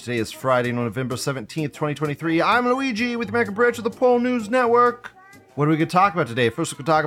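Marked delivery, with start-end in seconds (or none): 0.0-5.1 big showman announcer opening, pitched high; show host intro. Acted. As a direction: welcome the audience like a ringmaster.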